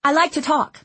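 high, eager reply. A narrator's reading. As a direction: chirp it out keenly. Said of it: "she replied eagerly".

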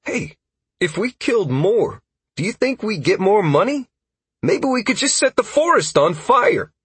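high-pitched eager chatter; excited. A narrator's reading. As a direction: pitch ideas eagerly, voice climbing. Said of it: "Constantine exclaimed excitedly".

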